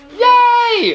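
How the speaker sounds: high, loud cheer of delight; loud yay. Real, not acted. Friend promises to buy a frame, loud joyful cheer.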